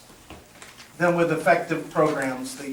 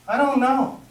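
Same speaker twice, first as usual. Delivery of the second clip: higher, bemused answer through a laugh; Pete clueless; 6 semitones higher than usual. Retold or imitated. A castmate who forgot his line, laughing cluelessly. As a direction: play him lost and cheerfully blank. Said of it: "he leans over to me and goes".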